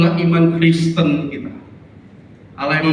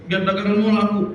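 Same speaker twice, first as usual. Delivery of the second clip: high-pitched acted urging; imitated trader's persuasion; coaxing fellow vendors; 7 semitones higher than usual. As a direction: coax a friend along in a raised pitch. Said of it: "teman-temannya di sekitar yang dagang itu selalu bilang begitu".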